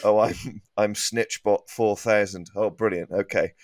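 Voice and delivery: ordinary talk, nothing marked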